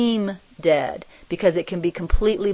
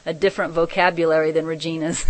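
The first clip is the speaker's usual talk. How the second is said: ordinary talk, nothing marked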